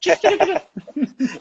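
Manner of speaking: high burst of laughter; laughing. Real, not acted. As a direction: cackle of amusement. Reacting to accent remark.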